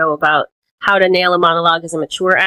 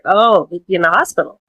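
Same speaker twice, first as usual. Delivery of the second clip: usual speaking voice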